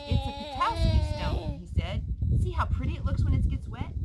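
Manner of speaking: a loud, eager rush about a find; excited. Acted. Boy shows his friend a shiny stone, loudly excited. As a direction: show off the stone you just found, loud and thrilled.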